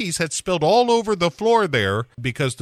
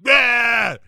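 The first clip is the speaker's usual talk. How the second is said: mock yell; 10 semitones higher, 4 dB louder than usual. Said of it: "just yells at this fish".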